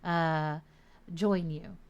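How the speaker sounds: normal talking voice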